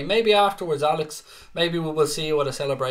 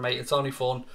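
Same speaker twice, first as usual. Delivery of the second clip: normal talking voice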